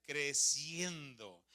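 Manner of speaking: slow emphatic answer. Shown as a demonstration. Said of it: "¿Qué iba?".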